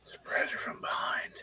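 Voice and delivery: soft, conspiratorial whisper. Acted. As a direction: whisper it like a secret plan. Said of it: "why are we whispering".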